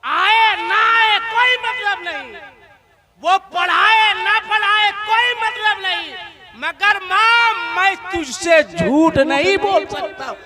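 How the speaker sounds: put-on a boy crying out to his mother; weeping boy. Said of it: "जारो कत्तार रोने लगे".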